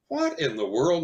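imitating a stunned workman; baffled. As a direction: act out the repairman's disbelief. Gas man baffled by screaming detector.

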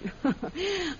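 high, light teasing laugh; flirty laugh. Acted. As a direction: laugh him off, playful. Teases the prince about his guard.

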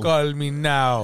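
drawn-out TV psychic pitch; Miss Cleo. Retold or imitated. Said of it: "Miss Cleo".

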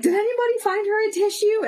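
high, fast imitation of a celebrity calling out; calling for help. Celebrity asking the room for a tissue, mock urgent call. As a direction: pitch it up and rush the request.

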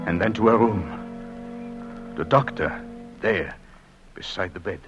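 low, halting, fading narration; hushed dread. Acted. Husband finding his wife's hospital bed, hushed.

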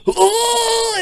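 sudden high yelp of pain; pained cry. Retold or imitated. Retelling how the speared buddy cried out, a high yelp. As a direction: cry out like the wind got knocked out.

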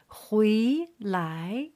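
回来 drawn out, each syllable rising; two second tones. Shown as a demonstration. Teacher modelling second tones, 回 and 来 both rising. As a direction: sweep 回 up, then 来 up. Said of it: "second line for the second tone".

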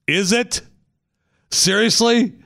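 high, sharp disbelief; can't believe what he heard; incredulous. Real, not acted. Reacts to wife's quote.

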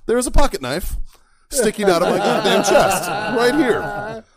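loud, high storyteller's big reveal; incredulous exclaiming. Real, not acted. Storyteller revealing the knife to friends, loud and incredulous. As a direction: raise the voice in amazed disbelief.

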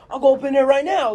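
high, loud cocky yap; little dog voice. Retold or imitated. The little dog threatening through the slit, high and loud. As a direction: bluster like a tiny tough dog. Voicing little dog's bravado.

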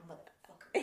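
breathy whisper of a swear; whispered mock grudge; whispered curse. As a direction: hiss the curse under your breath. Muttered curse at brother-in-law.

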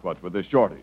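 usual speaking voice

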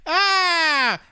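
a sudden high, loud yelp of laughter; delighted shriek. Real, not acted. Laughing at his own joke, a loud high yelp. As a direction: let out a loud squeal as the laugh peaks.

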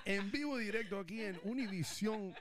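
high, fast Spanish TV announcer; lucha libre announcer. Pretend. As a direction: hype it up like Univision live. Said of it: "we hit the sap button ahí".

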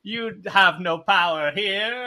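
slow, loud villain taunt; anime villain. Retold or imitated. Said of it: "like, evil, like, anime villain laugh".

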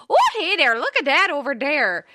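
high, loud ridiculous character voice; goofy cartoonish voice while driving; silly put-on voice. To her husband in the car, a ridiculous loud high voice. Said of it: "I will speak in the most ridiculous voices".

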